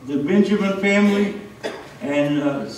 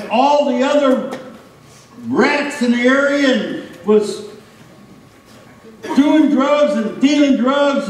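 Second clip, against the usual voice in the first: raised, indignant retort; angry older brother; 6 semitones higher than usual. Acted. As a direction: huff it out like the sulking older brother. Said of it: "The older brother was angry".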